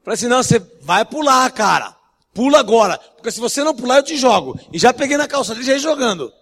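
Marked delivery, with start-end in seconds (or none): 0.3-4.6 loud, forceful order to jump; mock-menacing shout at the window; retold shouting threat. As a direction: bark it like a tough threat. Preacher retells threatening to throw him.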